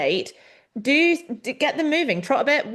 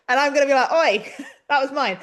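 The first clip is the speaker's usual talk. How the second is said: laughing through the words; giggling mock protest; amused, laughing out the complaint; 5 semitones higher, 4 dB louder than usual. Real, not acted. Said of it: "[name]'s laughing".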